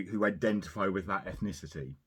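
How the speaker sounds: ordinary delivery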